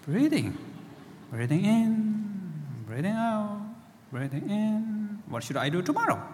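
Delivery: the sheepish monkey mind forgets and wanders. Acted. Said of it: "And the monkey mind says".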